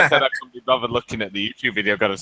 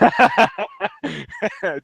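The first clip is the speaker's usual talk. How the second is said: high laugh; 3 dB louder than usual. Real, not acted. Laughing at own joke.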